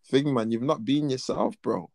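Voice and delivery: ordinary talk, nothing marked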